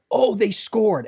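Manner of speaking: high-pitched retold goal cheer; excited cheer. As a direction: act out celebrating a goal.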